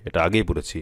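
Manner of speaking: normal talking voice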